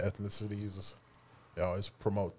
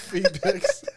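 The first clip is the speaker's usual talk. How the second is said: laughing; 11 semitones higher, 7 dB louder than usual. Real, not acted. Laughing at own toe slip.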